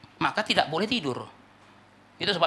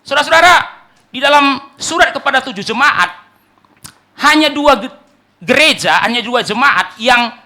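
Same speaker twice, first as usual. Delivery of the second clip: loud, high-pitched sermon delivery; forceful preaching; 9 semitones higher, 12 dB louder than usual. Real, not acted. Preacher announcing Jesus' stern voice to the church, forceful preaching. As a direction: proclaim it loudly to the whole congregation.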